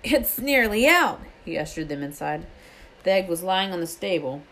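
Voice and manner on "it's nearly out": high, drawn-out excited announcement; flushed and excited. A narrator's reading. Hagrid greets friends as the egg hatches, excited. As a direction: announce it eagerly, stretching the words. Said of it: "looking flushed and excited".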